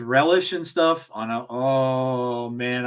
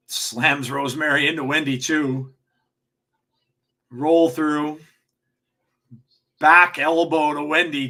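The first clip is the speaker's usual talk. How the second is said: wrestling commentator. Real, not acted. Calls the wrestling match live.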